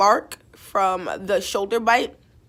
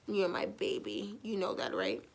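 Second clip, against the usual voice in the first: hushed and tender, cooing at the dog; soft doggy talk; 3 semitones lower, 10 dB quieter than usual. Real, not acted. Owner coos softly to her beloved dog. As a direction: murmur it softly to your pet.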